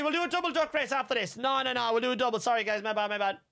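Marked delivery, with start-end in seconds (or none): none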